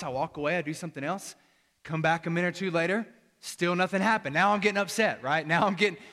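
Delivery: ordinary talk, nothing marked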